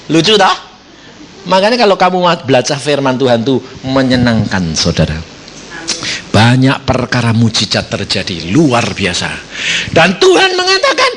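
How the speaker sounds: usual speaking voice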